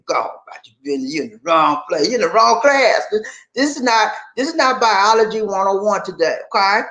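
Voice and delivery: usual speaking voice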